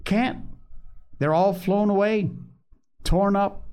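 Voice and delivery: put-on high-pitched little-boy voice; reply as a child owning up; storyteller voicing young boys; boys answering. A narrator's reading.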